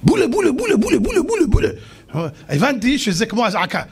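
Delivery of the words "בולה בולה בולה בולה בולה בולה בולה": high chanted shout of bula; tribesmen shouting. Retold or imitated. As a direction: call out bula like alarmed tribesmen. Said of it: "בני השבט צועקים".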